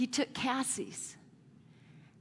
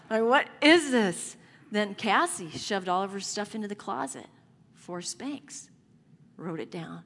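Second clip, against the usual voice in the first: usual speaking voice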